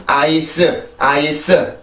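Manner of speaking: 아이스 slow, in full syllables with an 으 vowel after S; wrong Korean-style pronunciation. Shown as a demonstration. Teacher warning against this, 아이스 with added 으. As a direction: spell out 아이스 evenly, ending on a clear "seu". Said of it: "이런 발음이 아니에요".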